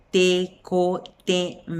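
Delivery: "Tecoteme" muy lento: te, co, te, me; silabeado correcto. Shown as a demonstration. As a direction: dicta "Tecoteme" con pausas entre sílabas. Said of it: "Esa es la palabra correcta". ("Tecoteme" pausado, cada sílaba completa)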